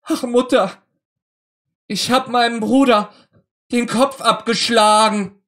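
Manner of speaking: hohe, laute, verzweifelte Kinderstimme; verzweifeltes Weinen. A narrator's reading. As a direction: weinend zur Mutter laufen und es herausschreien. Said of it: "fing gar bitterlich an zu weinen und zu schreien". (Marlenchen gesteht der Mutter schluchzend und laut)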